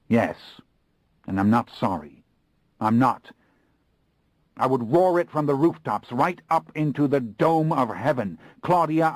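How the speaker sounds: high, loud defiant cry; defiant declaration. A narrator's reading. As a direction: proclaim it to the heavens.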